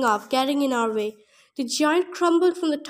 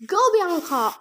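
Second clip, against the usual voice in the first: a read-out shout, only a little raised; mild yell; 4 semitones higher than usual. A narrator's reading. Narrator yelling to Bianca inside the giant, mild shout. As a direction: urge her on with some force. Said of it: "I yelled".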